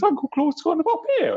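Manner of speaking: very high-pitched character voice; Uncle Claude. Shown as a demonstration. Demonstrating an audiobook character's register, high-pitched put-on voice. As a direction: speak way up at the top of your register. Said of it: "They speak at different registers".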